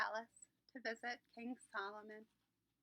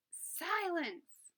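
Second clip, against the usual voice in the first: higher, sharp shout for silence; 6 semitones higher, 4 dB louder than usual. Acted. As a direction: shout down the buzzing crowd. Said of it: "King Solomon shouted".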